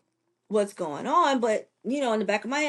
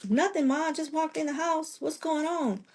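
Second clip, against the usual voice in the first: higher, lightly acted phone reply; retold wary reply; 9 semitones higher than usual. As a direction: answer mom in a raised pitch, lightly.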